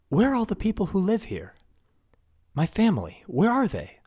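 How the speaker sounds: high, loud, rising panic; demanding answers in alarm; frantic disbelief. Acted. Princess panicking about her missing family.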